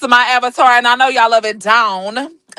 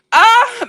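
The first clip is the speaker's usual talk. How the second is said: loud, high groan of frustration; frustrated groan; 11 semitones higher, 4 dB louder than usual. Real, not acted. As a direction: let out an exasperated high groan. Frustrated by the phone glitch.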